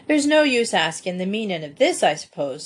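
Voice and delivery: plain reading voice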